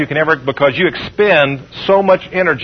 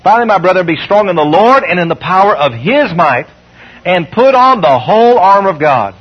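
scripture reading. Acted. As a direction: proclaim the verse like a herald. Reads Ephesians six ten.

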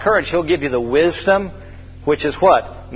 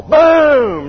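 splash boom; 11 semitones higher, 7 dB louder than usual. Retold or imitated. Cannonball into the sea.

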